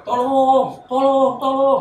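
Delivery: high, loud drawn-out cry for help; long, wailing yell; screaming for help. Retold or imitated. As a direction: re-enact a desperate scream. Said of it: "Itu pas duduk teriak".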